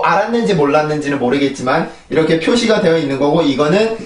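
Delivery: usual speaking voice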